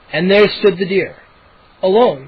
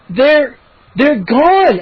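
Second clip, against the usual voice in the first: slow urgent stammer; 7 semitones higher, 5 dB louder, much slower than usual. A narrator's reading. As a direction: stumble over the words. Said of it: "I stammered".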